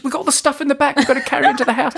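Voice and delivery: high, fast, laughing mock complaint; mimicking Kylie. Teller mimics his fiancée complaining about carrying stuff, high and fast. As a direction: rattle it off in a squeaky nagging voice. Wife's quote in parking argument.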